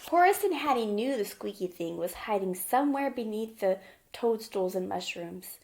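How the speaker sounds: usual speaking voice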